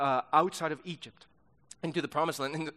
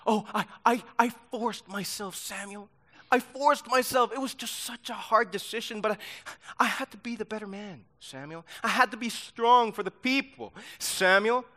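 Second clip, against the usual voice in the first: a lightly put-on whiny victim voice, higher; whiny Saul; 7 semitones higher, 7 dB louder than usual. Pastor mocking Saul's excuses to Samuel, higher whiny self-pitying voice. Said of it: "That's how he sounds like".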